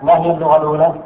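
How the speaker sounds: usual speaking voice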